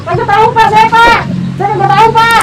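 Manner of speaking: panicked denial. Acted. Panicked plea to official.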